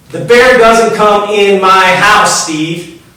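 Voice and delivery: slow, loud and high, laying down the law; furious father putting his foot down; angry shout. Retold or imitated. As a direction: bellow it slowly, drawing a hard line. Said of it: "I was mad".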